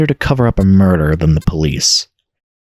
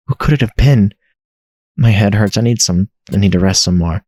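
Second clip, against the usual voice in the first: usual speaking voice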